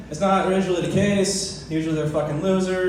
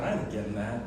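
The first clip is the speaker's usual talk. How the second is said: low grumbled refusal; 8 semitones lower, 11 dB quieter than usual. Retold or imitated. Sick on the couch, refusing to get the door, grumbling low. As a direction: lower and softer, refusing to budge. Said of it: "I'm like".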